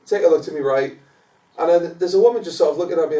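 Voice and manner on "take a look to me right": ordinary delivery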